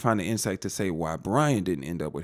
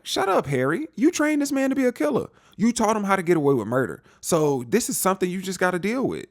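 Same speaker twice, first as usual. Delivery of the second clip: telling Harry off; 9 semitones higher than usual. Retold or imitated. Viewer scolding Harry.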